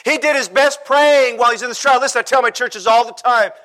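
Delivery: driving sermon delivery; impassioned preaching. Acted. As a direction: preach it with rising urgency. Preaches at full force.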